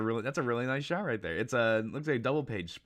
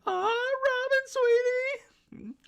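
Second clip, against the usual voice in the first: very high, loud, slow lovestruck coo; swooning Sanji; 8 dB louder, much slower than usual. Retold or imitated. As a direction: coo her name like a smitten fool.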